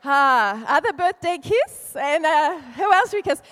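mock Italian flirting; Italian accent. Italians voiced in retold story.

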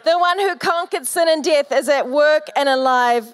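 rising triumphant proclamation; preaching at full force. Acted. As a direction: build it like a sermon peak. Sermon climax.

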